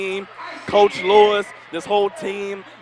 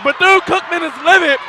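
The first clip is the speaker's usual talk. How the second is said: excited shouting; 9 semitones higher, 4 dB louder than usual. Real, not acted. Chaos after the buzzer.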